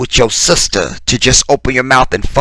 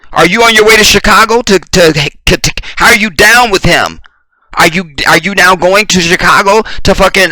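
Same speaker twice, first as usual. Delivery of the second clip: heated grilling; 5 semitones higher than usual. Real, not acted. Host grilling Steve, heated.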